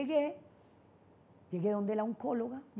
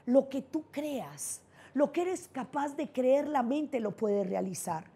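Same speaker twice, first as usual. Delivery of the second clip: slow solemn maxim; 3 semitones higher, slower than usual. Acted. Repeats maxim to the audience.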